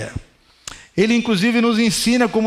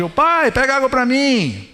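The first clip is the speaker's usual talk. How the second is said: higher, calling out to dad across the house; calling from afar; 5 semitones higher than usual. Retold or imitated. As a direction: call out to a father in the next room. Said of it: "de longe".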